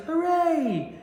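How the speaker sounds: very high, loud cheer; a joyful crowd cheer; cheering shout. A narrator's reading. Villagers cheering as the whale swims free, a high, loud hooray. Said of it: "villagers shouting".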